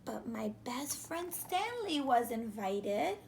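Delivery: usual speaking voice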